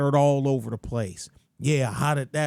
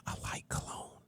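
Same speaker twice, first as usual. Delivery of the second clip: whispered aside; 18 dB quieter than usual. Real, not acted. Aside during the city list.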